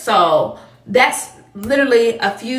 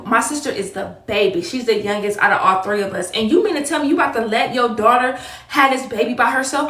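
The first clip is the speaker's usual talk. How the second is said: ordinary talk, nothing marked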